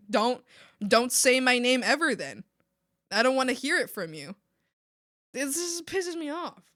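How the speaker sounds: normal talking voice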